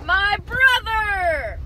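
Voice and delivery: high, loud, drawn-out announcement; announcer-style introduction. Pretend. Vlogger revealing their brother to viewers, drawn-out announcement. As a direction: stretch it out like a big reveal.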